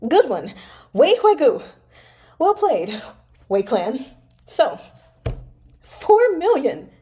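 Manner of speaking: high, loud bitter sneer; seething. Acted. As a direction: spit the praise back through fury. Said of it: "He flung the wine cup down and seethed".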